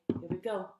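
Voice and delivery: ordinary delivery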